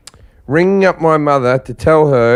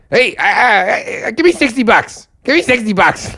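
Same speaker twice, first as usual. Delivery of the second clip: imitating Brian; 7 semitones higher than usual. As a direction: hold out the drug offer casually, higher. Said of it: "Like this, right?".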